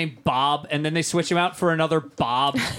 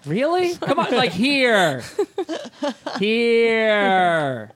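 exasperated mockery; 6 semitones higher, 4 dB louder, much slower than usual. Real, not acted. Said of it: "mocking the bad acting".

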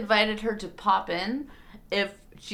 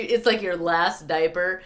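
usual speaking voice

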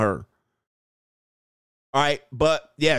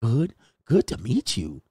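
bass taken out of the voice; 3 semitones lower, slower than usual. Retold or imitated. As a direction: drop the bass and speak gently. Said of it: "take the bass out of your voice".

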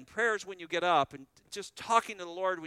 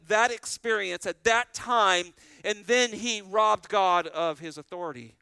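higher and louder, driving each phrase home; preaching at full force; 4 semitones higher, 7 dB louder than usual. Acted. Pastor warning the assembly about Moses, preaching at full force. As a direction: hammer it from the pulpit.

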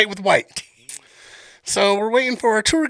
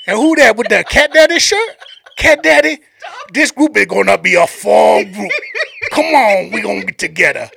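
put-on Sambo's Cajun accent; 7 dB louder than usual. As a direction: holler to the group in a Cajun accent. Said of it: "I'm gonna play this dude's accent".

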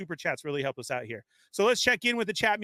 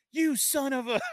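higher, half-laughing retold curse; mock cursing; 8 semitones higher than usual. Host retells cursing at the haunted touch lamp, mock anger. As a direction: act out snapping at the lamp, half in jest. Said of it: "I was all".

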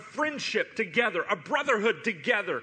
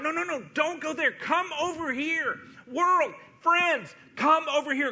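high, pleading holler, much higher; 7 semitones higher than usual. Pretend. Jesus hollering to the world to turn back, high pleading call. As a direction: call out urgently to stop them going. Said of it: "Jesus hollering to the world".

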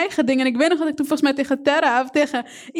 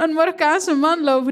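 ordinary delivery

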